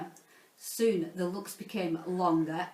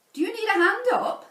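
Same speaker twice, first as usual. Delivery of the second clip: high, louder ladylike question; Miss Dovey's voice; 12 semitones higher, 6 dB louder, faster than usual. Acted. Miss Dovey to kneeling Mister Lovey, high and louder. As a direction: chirp it up brightly as the prim teacher. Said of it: "asked Miss Dovey".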